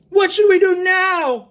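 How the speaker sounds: high, loud frantic duck; panicked cry. Acted. Duck panics as egg hatches.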